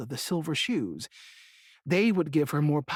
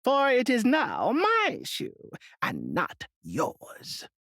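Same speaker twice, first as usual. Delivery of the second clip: high, slow witch voice; witch retorting; 7 semitones higher, much slower than usual. A narrator's reading. Wicked witch refusing the little girl, slow high retort. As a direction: spit it back slowly like an old witch. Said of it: "retorted the witch".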